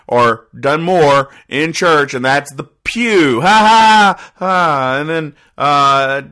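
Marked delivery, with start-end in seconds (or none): 3.4-5.0 mock ha ha. Real, not acted. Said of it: "sarcastic mock laugh at joke".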